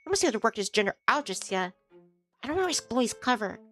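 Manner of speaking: usual speaking voice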